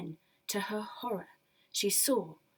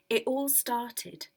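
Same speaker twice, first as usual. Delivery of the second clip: usual speaking voice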